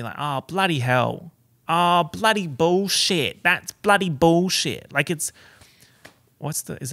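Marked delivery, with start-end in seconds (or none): 0.2-4.9 hammed-up Australian swearing on "bloody"; Aussie bloody. Shown as a demonstration. Australian showing how locals say "bloody". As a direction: lean on "bloody" like a fed-up Aussie. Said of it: "we say bloody".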